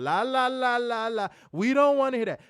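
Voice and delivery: high, loud singsong la la; taunting childish singsong, fingers in ears; mocking fans. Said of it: "fingers in their ears going".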